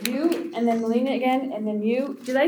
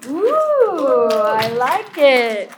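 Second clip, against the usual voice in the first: drawn-out, louder ooh of approval; delighted approval; 4 dB louder, much slower than usual. Real, not acted. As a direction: cheer the poet on, stretching the words. Host cheers on young poet.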